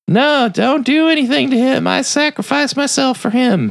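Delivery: very high mock heroine plea; pleading girl. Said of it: "the girl that tried to warn him".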